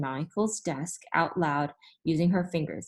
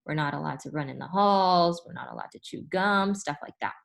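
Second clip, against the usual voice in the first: plain reading voice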